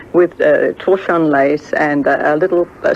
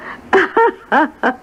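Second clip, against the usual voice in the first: high, delighted chuckle; amused laugh; 11 semitones higher than usual. Real, not acted. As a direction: laugh at the clever trick. Laughing at her own shrewdness.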